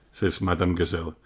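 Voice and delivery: ordinary delivery, nothing marked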